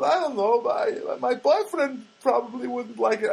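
high, drawn-out mock girl voice; put-on high, hesitant girlish voice; young Armenian girl. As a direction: imitate a young woman hedging. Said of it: "there's this Armenian girl there".

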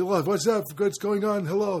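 lightly imitated old Yiddish accent; old neighbor with a hint of Yiddish accent. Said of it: "they talk like".

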